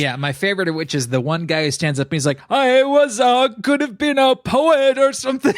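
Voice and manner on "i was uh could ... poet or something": high, loud mock-anguished wail; emotional diner. Imitating a man who stands up at dinner, mock anguish. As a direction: wail it like a hammed-up confession. Mimics a diner's tearful monologue.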